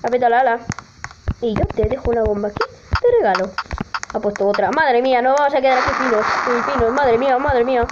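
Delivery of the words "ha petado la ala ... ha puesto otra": normal talking voice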